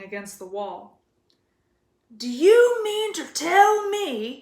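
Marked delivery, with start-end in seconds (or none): 2.1-4.4 slow, loud, raised growl. A narrator's reading. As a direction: growl it at the Dursleys. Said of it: "he growled at the Dursleys".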